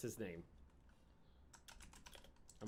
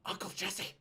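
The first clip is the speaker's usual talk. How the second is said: starstruck; 5 semitones higher, 5 dB quieter than usual. Retold or imitated. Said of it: "And I was like".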